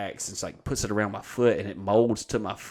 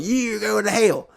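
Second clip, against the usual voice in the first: higher, slow hammed-up angry commenter; mocking haters; 11 semitones higher, 4 dB louder than usual. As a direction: sneer it like a mock troll.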